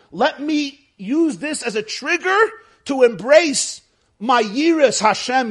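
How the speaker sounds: ordinary talk, nothing marked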